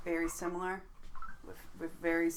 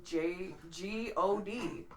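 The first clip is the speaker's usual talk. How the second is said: slow, separate letters; spelled out; 4 dB quieter, much slower than usual. Retold or imitated. Spells the word out for listeners.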